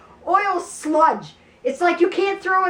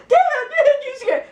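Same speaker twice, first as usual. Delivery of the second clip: very high, panicked mock cry; imitating a startled shriek, half laughing; screaming in fright; 13 semitones higher, 4 dB louder than usual. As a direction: squeal it like a scared kid. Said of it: "he's, like, screaming".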